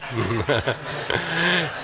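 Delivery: short real chuckle. Real, not acted. Preacher jokes about dad's prophecy, chuckling. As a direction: let out a small amused laugh.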